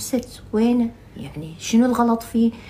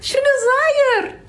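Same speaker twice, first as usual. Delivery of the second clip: laughing-crying impression; 4 dB louder, slower than usual. Real, not acted.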